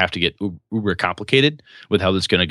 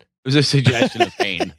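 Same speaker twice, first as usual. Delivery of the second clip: laughing; 10 semitones higher than usual. Real, not acted. Joking about threat, laughing.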